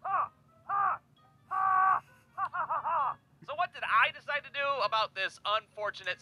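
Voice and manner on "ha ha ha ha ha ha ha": high, hammed-up moaning "ha, ha"; mock bedroom moaning, pitched way up; mock sex moans. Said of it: "Tom was clearly the more vocal".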